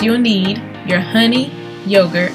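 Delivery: usual speaking voice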